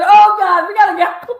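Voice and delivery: high, loud panicked yell. Retold or imitated. Telling her friend they must leave, in a high panicked yell. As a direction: yell it like you need out right now.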